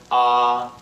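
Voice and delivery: "ah" held high and level; flat sustained "ah"; first tone. Shown as a demonstration. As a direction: keep "ah" on one steady note. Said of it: "high and level pitch".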